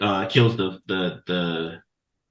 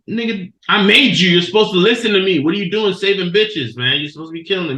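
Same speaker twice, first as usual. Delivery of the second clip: loud, high mock scolding from the priest; a hammed-up priest chewing out his creation; angry priest; 9 semitones higher, 4 dB louder than usual. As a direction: bark it like an outraged master.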